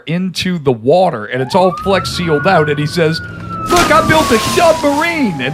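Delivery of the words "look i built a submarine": put-on high, Cajun-accented pitch man. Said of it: "it's all Cajun and shit".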